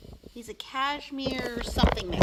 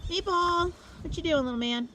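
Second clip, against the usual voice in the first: pet talk; 8 semitones higher than usual. Real, not acted. Calling a pet.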